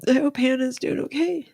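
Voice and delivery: high-pitched, real tearful voice; choked-up and high, pushing on through crying; tearful blubbering. Real, not acted. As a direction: talk through tears, voice lifted high. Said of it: "blubbering on".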